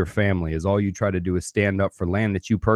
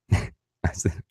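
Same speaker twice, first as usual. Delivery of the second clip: laughing whisper; 5 dB quieter than usual. Real, not acted. Whispered aside while laughing.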